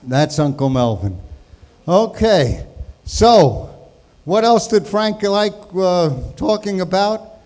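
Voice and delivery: ordinary delivery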